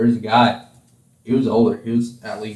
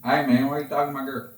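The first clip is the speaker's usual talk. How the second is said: put-on quick, soft country Southern accent; 9 dB quieter, much faster than usual. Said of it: "just like that".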